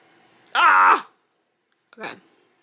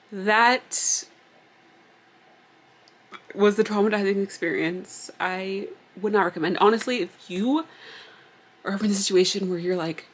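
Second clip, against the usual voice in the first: ordinary delivery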